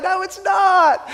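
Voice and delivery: slow, loud, high mock shout of protest; a parent woken at five, protesting; groaning refusal.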